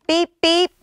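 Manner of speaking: high, loud electronic beeps; video game beeps. A narrator's reading. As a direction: imitate a game console beeping. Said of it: "goes the video game".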